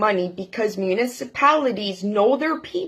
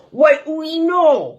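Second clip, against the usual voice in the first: higher, slow drawn-out politician voice; lightly mocking a politician; 5 semitones higher, slower than usual. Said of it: "When the politicians talk like this".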